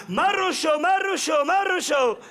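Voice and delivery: shouting a name across the street; calling out. Acted. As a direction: yell the name from far off.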